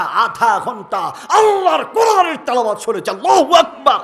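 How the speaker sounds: loud preaching shout; shouted punchline. Real, not acted. Preacher shouting to the crowd at the waaz.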